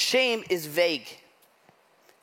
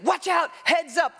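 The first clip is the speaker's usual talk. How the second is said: retold yelling; 9 semitones higher than usual. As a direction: call out a sudden warning to the crowd. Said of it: "I hear yelling".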